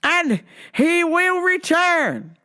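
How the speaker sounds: mock zealot lecturing a cashier; preachy Rome guy. Man preaches again.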